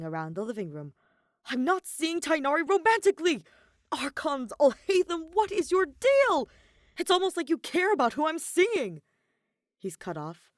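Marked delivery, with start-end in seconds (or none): 1.5-9.0 much higher, much louder exasperated yell; furious shout. A narrator's reading. Kaveh at his boiling point, shouting at Alhaitham. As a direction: explode at him, out of patience. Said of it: "Kaveh hits his boiling point".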